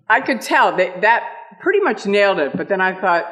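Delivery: usual speaking voice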